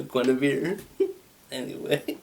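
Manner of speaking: laughing through the words; cracking up mid-sentence. Real, not acted. Amused at his own joke, laughing.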